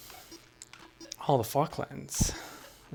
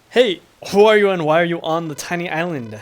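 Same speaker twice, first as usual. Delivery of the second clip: loud, higher-pitched demand; challenging call; 4 semitones higher, 9 dB louder than usual. Acted. Game character confronting an islander, loud challenge. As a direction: call out sharply to a stranger.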